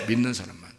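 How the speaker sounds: ordinary talk, nothing marked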